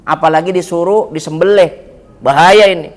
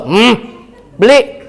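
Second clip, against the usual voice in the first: stern order; 4 semitones higher, much slower than usual. Retold or imitated. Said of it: "Saya melotot juga".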